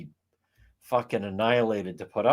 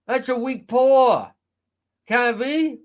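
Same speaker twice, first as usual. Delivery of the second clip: high, loud nasal-free Fall River mimicry; mock her thick Fall River accent, loud and high; 9 semitones higher, 8 dB louder than usual. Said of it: "with the fucking Fall River accent".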